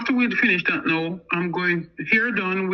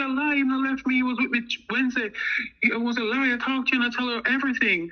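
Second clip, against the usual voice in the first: retold yelling; 6 semitones higher than usual. Other woman contradicting the man, retold yelling without volume. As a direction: imitate an upset woman, pitched up. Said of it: "So she was yelling".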